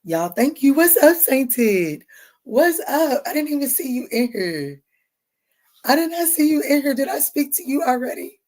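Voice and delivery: high, loud, delighted hello; light up as you spot a friend in chat; excited greeting. Real, not acted. Streamer greeting a viewer she missed, high excited hello.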